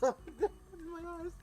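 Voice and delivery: high laugh dragging the words slowly; real giggle while complaining; laughing through words. Real, not acted. Laughing, bugs in eyes.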